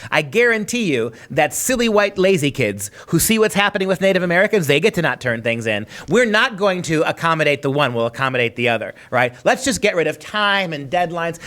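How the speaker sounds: ordinary delivery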